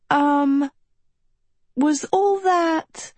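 high, drawn-out uncertain question; hesitant questioning. A narrator's reading. Agatha turns to her class after the fish vanishes, hesitant question. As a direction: stretch it out, unsure. Dazed heroine asks a question.

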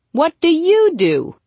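loud, high, drawn-out plea; mock desperate cry. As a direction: wail the question, stretching each word. Repeats the question in mock panic.

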